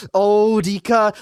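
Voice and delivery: slow, drawn-out mock sigh of a name; lightly voiced sulky girl, dragging the name out; passive-aggressive Hanyu. Mocking Hanyu's guilt-trip to Rika, drawn-out and slow. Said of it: "she's so passive aggressive about it".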